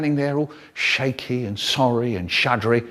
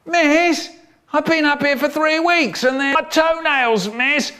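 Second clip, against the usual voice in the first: high-pitched mock complaint; wailing schoolchild; 12 semitones higher than usual. Pupil strung up from the wall bars, high plaintive wail. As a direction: wail high like an aggrieved little kid.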